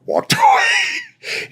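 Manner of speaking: bursting into a high, slow laugh. Real, not acted. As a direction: let the laugh break the words. Punchline of his story.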